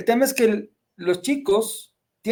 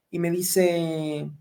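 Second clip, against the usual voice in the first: ordinary delivery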